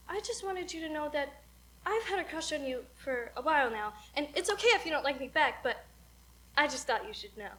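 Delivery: soft, low, sincere; tender confession. Acted. [name] admitting her crush in rehearsal, softly sincere. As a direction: confess it quietly, leaving room between phrases.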